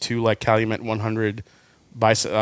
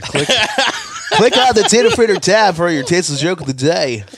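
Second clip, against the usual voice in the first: higher, louder hyped radio DJ voice; eighties shock jock; 5 semitones higher, 5 dB louder than usual. Pretend. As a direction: pump it up like a late-night radio host. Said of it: "You sound like an eighties shock jock".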